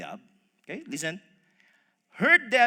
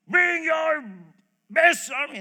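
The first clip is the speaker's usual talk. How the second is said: loud, high shouted challenge; Goliath; 7 semitones higher, 8 dB louder, slower than usual. Acted. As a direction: bellow a taunt across the battlefield. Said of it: "shouting the usual taunt".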